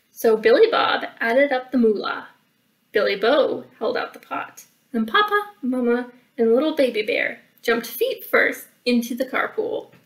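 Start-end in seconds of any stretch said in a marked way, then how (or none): none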